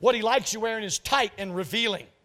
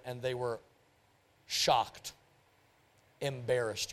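hushed; 8 semitones lower, 7 dB quieter, slower than usual. Real, not acted. Preacher describes couple's shame.

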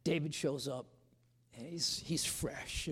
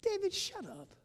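inner exasperation. Retold or imitated. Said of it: "and I'm thinking".

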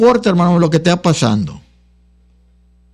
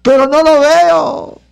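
doubting believer; 9 semitones higher, slower than usual. Acted. Voicing a doubting church member.

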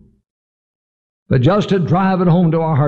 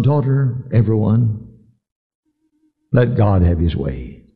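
solemn closing appeal; 3 semitones lower than usual. Real, not acted. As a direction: lower the voice and space out each phrase.

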